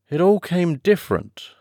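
mildly thoughtful Mock Turtle. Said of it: "repeated thoughtfully".

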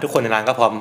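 ordinary delivery

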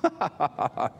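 short incredulous chuckle; a mock laugh of disbelief; acted laugh. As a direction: laugh like an old man hearing the impossible. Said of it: "What a laugh".

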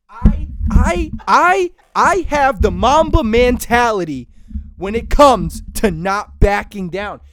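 loud, defiant hype; fired up. Pretend. Boasting of his Mamba mentality, loud and fired up. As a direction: declare it like a pumped athlete. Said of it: "I'm getting fired up".

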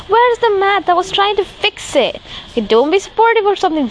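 high, fast frustrated retort; retold snap. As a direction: fire it back at mom, fed up. Retold angry reply to mom.

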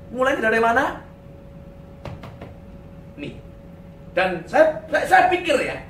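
ordinary talk

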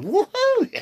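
very high, loud cheer with laughter; gleeful whoop. Real, not acted. Runner cheering being back at it, gleeful whoop. Celebrates being able to run again.

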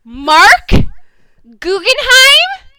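loud, rising name called out; outraged disbelief. Retold or imitated. Fan blaming the showrunner, loud incredulous cry. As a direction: call the showrunner's name in shocked accusation. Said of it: "And I was like".

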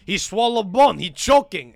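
loud, high mock Russian panic; Russian accent. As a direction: shout it in a put-on Russian accent. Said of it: "This fat Russian".

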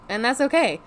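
usual speaking voice

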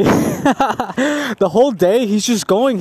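tickled, high and drawn-out; laughing. Real, not acted. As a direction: crack up, then get the words out. Breaks up telling funny story.

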